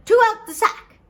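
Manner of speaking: high, loud magic command; commanding call. Acted. Poor man ordering the sack to open, commanding. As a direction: call it out sharply at high pitch.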